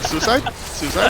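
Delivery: mock eager question. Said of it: "You're like".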